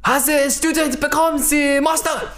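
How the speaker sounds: hammed-up Japanese accent, student asking. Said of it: "Two of the worst Japanese accents".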